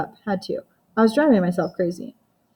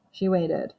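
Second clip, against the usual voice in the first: ordinary delivery, nothing marked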